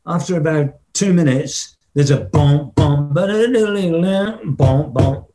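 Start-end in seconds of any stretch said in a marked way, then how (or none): 2.2-5.3 bump bump ba da, mouthed drum fill; vocal drum fill. Retold or imitated. [name] mouthing the drum fill in the hotel room, vocal drum beat. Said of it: "And he goes".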